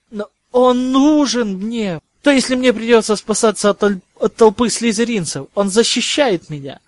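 urgent plea. A narrator's reading. Pupil pleading with professor to keep the time-turner, urgent protest. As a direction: plead insistently, raising the voice.

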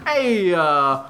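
laughing through a cartoon Italian guy; mock Italian tough guy. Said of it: "this dude couldn't look less Italian".

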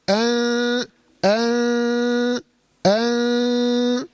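أن held very long as a hum through the nose, tongue tip hanging; pure nasal ghunna. Shown as a demonstration. Teacher demonstrating the pure nasal ghunna of ikhfa. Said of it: "غنة خيشومية بحتة".

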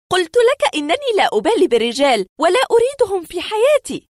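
high, loud indignant protest; angry woman. A narrator's reading. Patient rejects the doctor's theory about men, angrily. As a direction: fire back in an offended woman's voice. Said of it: "قالت في غضب".

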